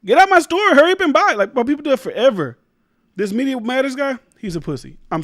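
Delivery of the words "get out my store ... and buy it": much higher, louder mock shopkeeper accent; mock Korean accent. Vlogger imitating the Korean beauty supply lady, high and loud. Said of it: "she said".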